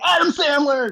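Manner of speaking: high, eager cheer; mock excitement. Recalling his hopes going into the movie, high and excited. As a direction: exclaim it like a fan expecting a comedy. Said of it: "I was like".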